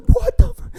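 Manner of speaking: high, loud shocked cry; startled outburst. Retold or imitated. Startled by the screaming woman, high loud frightened cry. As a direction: blurt it out in sudden fright. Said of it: "I was so scared".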